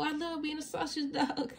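high, laughing through the cheer. A narrator's reading. Bluey cheering for Snickers, laughing. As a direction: burst out laughing with joy as you say it.

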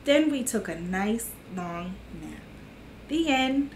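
storybook voice for little listeners; gentle children's book reading. A narrator's reading. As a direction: read it like storytime to kids. Reads picture book to kids.

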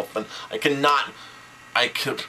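usual speaking voice

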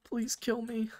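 high, hushed, broken plea; a weak, pained whisper of a request; dying plea. Acted. Button in agony begging her friend, high and faint.

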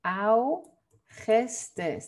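algestes, slow, "ow-KHES-tes"; correct Dutch version. Shown as a demonstration. Teacher showing the month "algestes" in Dutch, slow "ow-khes-tes". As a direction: sound out "algestes" slowly with short E vowels. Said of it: "Tú tienes que pronunciarlo así".

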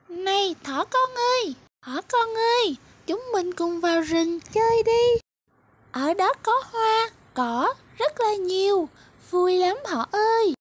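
very high, light butterfly voice; high sing-song butterfly luring the bunny out to play; cheery little butterfly. Acted. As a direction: chirp it like a tiny butterfly coaxing a friend. Butterfly character invites rabbit.

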